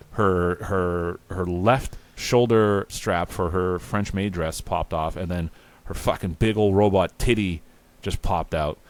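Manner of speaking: ordinary talk, nothing marked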